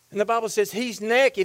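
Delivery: ordinary talk, nothing marked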